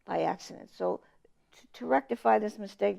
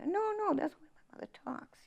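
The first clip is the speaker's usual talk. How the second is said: hushed reply; 12 semitones higher, 6 dB quieter than usual. Retold or imitated. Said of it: "she'd say".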